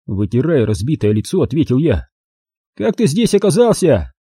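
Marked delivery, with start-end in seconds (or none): 2.8-4.1 high, offhand thug threat; casual thug threat. Acted. Thug casually threatens to cut off his ears, high and offhand. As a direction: threaten as if it were nothing.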